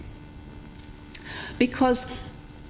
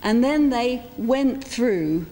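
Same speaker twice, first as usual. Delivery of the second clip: ordinary talk, nothing marked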